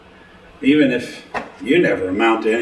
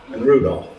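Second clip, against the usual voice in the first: ordinary delivery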